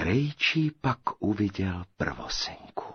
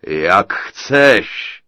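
loud, booming giant's jeer; gloating giant; 11 dB louder than usual. A narrator's reading. Giant answers the girl's refusal, loud gloating boom. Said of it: "zachechtal se obr".

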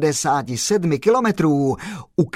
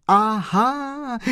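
a high, bright exclamation of sudden understanding; the postman cries out to the chauffeur, joyful aha; joyful cry; 8 semitones higher than usual. A narrator's reading. Kolbaba realizes the truth, joyful high cry. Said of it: "vykřikl pan Kolbaba radostně".

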